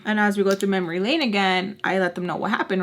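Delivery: normal talking voice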